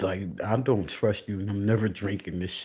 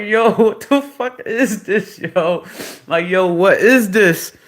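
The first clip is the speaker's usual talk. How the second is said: high, very loud mock outrage; retold outraged shout; 12 semitones higher, 16 dB louder than usual. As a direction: demand it loudly, acting out the shock. Retells outraged reaction to drink.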